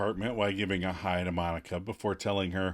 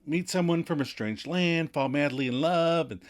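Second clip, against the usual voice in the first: animated Monica; 7 semitones higher than usual. Retold or imitated. Monica gushing to Ross about romance, much higher and excited. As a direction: gush about the fantasy. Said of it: "get all Monica animated".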